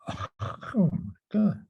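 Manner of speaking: slow, drawn-out gasp of wonder; awed realisation. Retold or imitated. Mentor grasps the gift-of-sight idea, awestruck. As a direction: let it dawn on you, breathy and slow. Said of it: "like, this oh moment".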